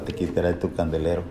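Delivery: normal talking voice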